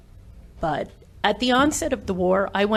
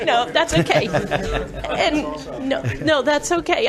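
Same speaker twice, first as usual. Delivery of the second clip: emotional laugh; 6 semitones higher, slower than usual. Real, not acted. Overcome speaker laughing off emotion.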